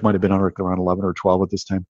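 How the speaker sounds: usual speaking voice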